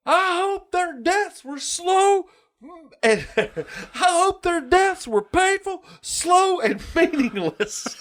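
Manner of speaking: high, slow, gloating quote of the teacher; mock teacher voice. Retelling the cartoon teacher cursing the dead boys, high and slow. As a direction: squeeze out each wish slowly, relishing it. Quoting Mister Buzzcut's hateful wish.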